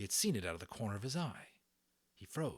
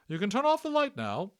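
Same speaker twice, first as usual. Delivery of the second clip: high loud brisk nurse voice; a bustling hospital matron; hearty Matron; 13 semitones higher, 10 dB louder than usual. Acted. Matron reassuring Omri about the Indian, high and hearty.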